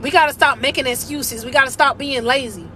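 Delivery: usual speaking voice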